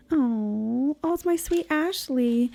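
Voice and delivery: high, tender coo. Real, not acted. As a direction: gush over a sweet photo. Cooing over photo of Ashley.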